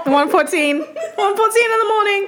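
high, laughing through words. Real, not acted. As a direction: giggle out the time. Laughing at the late hour.

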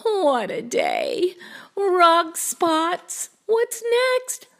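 put-on high, exasperated kid voice; high-pitched child's protest; dismayed boy.

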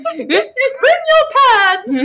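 high, loud, very slow drawn-out call; drawn-out shout. Acted. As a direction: stretch every word out as a loud chant. Hyping the joke podcast name.

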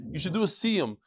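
ordinary talk, nothing marked

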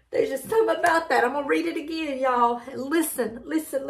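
ordinary talk, nothing marked

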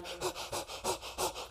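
acted rapid, shuddering gasps; shivery cold gasps. Dad treading icy water, gasping. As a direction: pant as if freezing.

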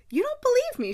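shocked he doubts her; stunned disbelief. Acted. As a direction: Kate hurt by Anthony's disbelief. Kate, stunned.

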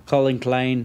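usual speaking voice

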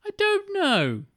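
clueless screenwriter, high pitched; high mock filmmaker voice. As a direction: shrug it off in a squeaky voice. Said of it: "It's like".

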